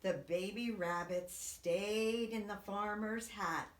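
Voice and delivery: ordinary delivery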